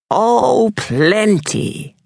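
high, mocking old witch drawing out plenty. A narrator's reading. Old witch mocking the scared boys, sneering. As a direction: sneer it like a crone. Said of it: "her voice would tear tin plate".

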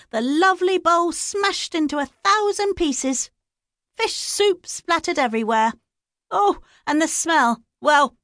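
high, theatrical gossip; milk the disaster for drama; high busybody wallowing in scandal; gossipy village woman. A narrator's reading.

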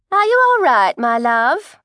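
concerned coat check lady asking a fainting pregnant woman; kindly coat check lady. Acted. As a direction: fuss over her like a kindly auntie. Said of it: "inquired a voice to her left".

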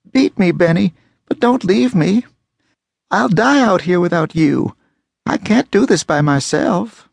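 high, pleading frightened woman; desperate begging. Acted. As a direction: beg him, terrified of being left. Carrie begs at his feet.

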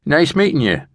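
put-on big guy's high-pitched Brooklyn accent. A narrator's reading. Big guy meeting a borrower, Brooklyn accent. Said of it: "said with a Brooklyn accent".

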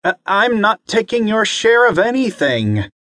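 put-on a kid protesting his innocence; little boy. Bobby denying he takes the lion's share, child voice.

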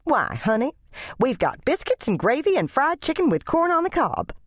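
high sugary lady voice listing the food; cooing church lady. Acted. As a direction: sweet-talk the child, high. Church lady coos at child.

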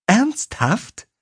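woman blurting out her shock on the phone; blurted out. A narrator's reading. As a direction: blurt it out in disbelief. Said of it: "platzte Alyssa heraus".

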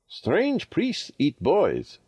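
ordinary delivery, nothing marked

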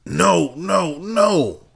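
high, loud panicked cry; barked. A narrator's reading. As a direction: cry out in panic, almost screaming. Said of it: "he barked".

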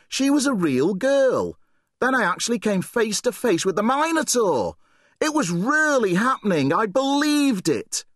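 put-on high, fast-rushing boyish gush; excited babbling. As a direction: tumble out the words like a thrilled kid. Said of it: "excitement and fear in his son's voice".

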